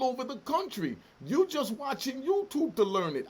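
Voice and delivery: emotional rant. Real, not acted. Ex-prisoner berating a YouTube-taught commenter, emotional and angry. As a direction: tear into them, hammering key words. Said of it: "getting so, you know, emotional".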